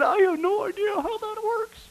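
high, whiny mock cry; mock helpless whine. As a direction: whimper it like a lost believer. Mocking confused churchgoer.

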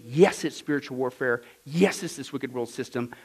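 usual speaking voice

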